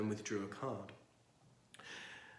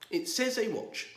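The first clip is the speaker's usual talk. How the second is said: firm; 10 semitones higher, 4 dB louder than usual. Acted. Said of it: "he said firmly".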